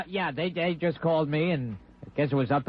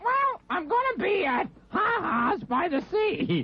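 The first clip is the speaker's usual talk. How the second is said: high wailing cry-shout; strained wailing shout; 14 semitones higher, slower than usual. Acted. Plug in a strained yelling voice.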